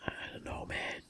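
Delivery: hushed whisper. Real, not acted. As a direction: whisper it under your breath. Whispered aside during commentary.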